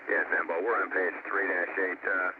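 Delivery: crackly shuttle radio transmission; breaking up space radio. Real, not acted. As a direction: voice through distant air-to-ground radio. Shuttle crew calling over radio.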